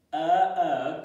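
loud punchy sound call; shouted sound. Shown as a demonstration. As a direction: belt out the sound. Phonics chant, shouted sound.

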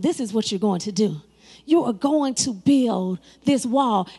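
a lightly voiced God, pitched well down; God's voice. God telling the builders what to do, low and measured.

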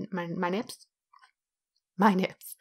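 soft, low put-on voice. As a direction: drop low and quiet, playfully affected. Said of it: "I'm suddenly talking like that now".

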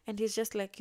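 usual speaking voice

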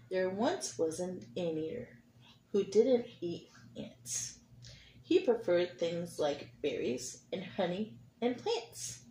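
gentle read-aloud storytelling; reading to children. A narrator's reading. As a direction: read it like a picture book for kids. Teacher reads picture book aloud.

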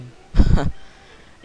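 sudden burst of laughter; loud laugh. Real, not acted. Laughs at Zubat learning move.

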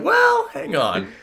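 mock objection. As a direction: stretch it out in disbelief. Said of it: "I was like".

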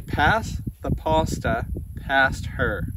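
"Pass the pasta past her" said slowly, each word drawn out; slow, careful reading in an Australian accent; slow careful Aussie phrase. Shown as a demonstration. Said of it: "So Joe's gonna say".

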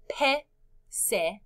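"pecera" slowly split into pe, ce, ra; syllable by syllable. Shown as a demonstration. Teacher introduces the next word, "pecera" in slow syllables. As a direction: read "pecera" with gaps between pe, ce and ra. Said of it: "Cuántas sílabas en pecera".

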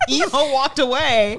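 usual speaking voice

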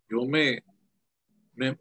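ordinary talk

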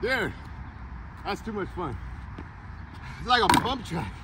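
panting after skating the ditch; winded, catching breath between words. Real, not acted. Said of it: "I'm already out of breath".

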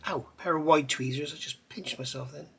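ordinary delivery

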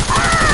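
short high yelp, loud; sudden shriek. Real, not acted. Streamer yelping when hit in the fight, a loud shriek. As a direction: yelp as you get shot.

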